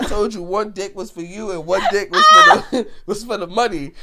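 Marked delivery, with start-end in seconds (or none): 2.1-3.9 high, loud laughing break on the words. Real, not acted. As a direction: crack up mid-sentence.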